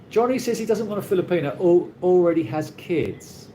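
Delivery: ordinary talk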